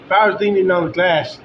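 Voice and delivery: usual speaking voice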